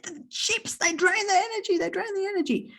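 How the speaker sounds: high, mock friend's warning. Quoting a friend about chips draining phones, high mock warning. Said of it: "she said".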